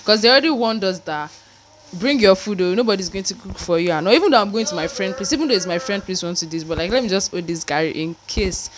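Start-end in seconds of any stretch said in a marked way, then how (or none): none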